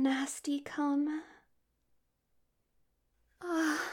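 breathy moaning, loud pleading bursts; seductive voice. Acted. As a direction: beg lustfully, swinging between whisper and moan. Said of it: "Seductive voice activated".

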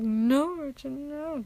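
put-on high, dramatic old-movie plea; Kate McKinnon. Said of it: "that Kate McKinnon impression".

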